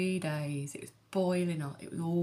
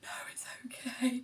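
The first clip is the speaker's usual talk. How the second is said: whispered reply; 8 semitones higher, 3 dB quieter than usual. Retold or imitated. Reassuring in the ambulance.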